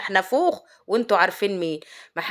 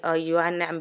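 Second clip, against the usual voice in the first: usual speaking voice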